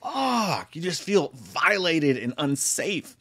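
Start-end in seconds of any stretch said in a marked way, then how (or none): 0.0-0.8 high despairing fuck. Retold or imitated. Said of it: "And you're like".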